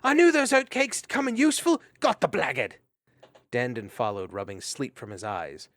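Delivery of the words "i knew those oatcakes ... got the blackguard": high, loud victory shout; triumphant yell. Acted. Mariel running at the felon, yelling in triumph. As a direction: crow over the fallen thief. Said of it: "the mousemaid was yelling".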